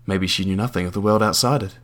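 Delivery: ordinary delivery, nothing marked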